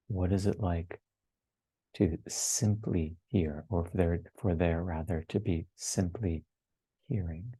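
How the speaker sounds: unhurried contemplative guidance, letting each phrase settle; guided meditation. Real, not acted. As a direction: lead listeners gently inward, phrase by phrase.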